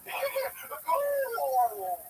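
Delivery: wheezy high cackle; old timer cackle. Acted. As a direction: cackle like a grizzled prospector. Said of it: "my impression of, uh, an old prospector".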